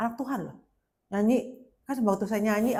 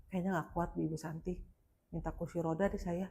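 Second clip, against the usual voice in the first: low, soft retold admission; meek giving in; 4 semitones lower, 9 dB quieter than usual. Injured woman to Ibu Santi, meekly asking for help. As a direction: quietly give up, drained of fight.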